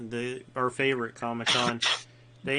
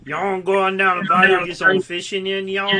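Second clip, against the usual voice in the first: put-on Cajun accent; 6 semitones higher, 11 dB louder than usual. Showing off untranslatable Gulf Coast English, thick Cajun accent. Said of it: "some English that you can't translate".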